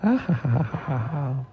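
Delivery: spoken mock laughter for the characters; read-out "ha ha" chorus, lightly acted. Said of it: "all laughed".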